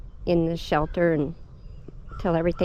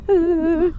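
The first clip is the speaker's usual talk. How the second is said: high, louder mock whimper; imitated near-crying whimper; 10 semitones higher, 5 dB louder than usual. On the phone with praying friends, imitated high whimper. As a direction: act out being about to cry. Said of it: "I'm basically about to cry".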